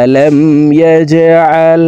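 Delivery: slow tajweed chant; measured teaching chant; Quran recitation. Shown as a demonstration. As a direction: recite with sustained vowels.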